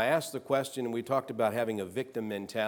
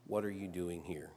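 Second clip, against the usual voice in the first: much softer and lower, near a hush; still small voice; 4 semitones lower, 9 dB quieter than usual. Acted. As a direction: let the question fall to a gentle murmur. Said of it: "a still small voice said".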